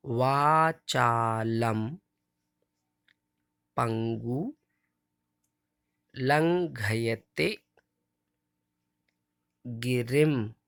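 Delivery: each word slowly separated, वाचालम held long; slow model recitation. Shown as a demonstration. Teacher reciting each word slowly for children to repeat. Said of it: "इसलिए क्या बोलेंगे?".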